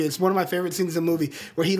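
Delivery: ordinary talk, nothing marked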